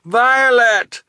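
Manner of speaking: high, loud shout over roaring water; urgent high call. A narrator's reading. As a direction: yell the name to carry over a waterfall. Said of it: "Quigley shouted over the roar".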